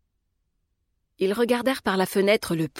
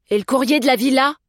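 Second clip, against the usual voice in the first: higher, louder unruffled question; undaunted, raised-voice inquiry; unfazed; 6 semitones higher, 6 dB louder than usual. A narrator's reading. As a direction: ask it brightly, not put off at all. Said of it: "demanda Augusta sans se démonter".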